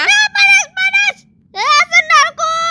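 high loud hot-hot cries; mock pain. Feet burning in lava, high loud yelps. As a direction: yelp from the lava heat.

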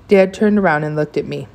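plain reading voice

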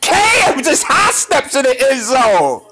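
high, slow, drawn-out hype; awed high drawl; hyped slow exclaim. Real, not acted. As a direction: stretch it out in awe. Hyped watching highlight.

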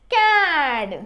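"క్యాడ్" with long vowel, falling pitch; pronunciation demo. Shown as a demonstration. Teacher modelling how it is read, "క్యాడ్" drawn out. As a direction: stretch "క్యాడ్" as one blended word. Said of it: "అని పలుకుతుంది".

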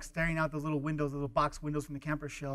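normal talking voice

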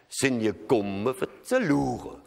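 slow Rhenish dialect with an unstressed "zu"; Rhenish landlord. Retold or imitated. Landlord at the door asking in thick Rhenish dialect. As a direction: imitate the landlord's Rhenish, unhurried. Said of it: "mein Hauswirt steht da und sagt".

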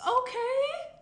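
high, loud mock-offended okay; indignant okay. Friend teased about her dry spell, high mock-offended okay. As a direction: snap back in mock offense.

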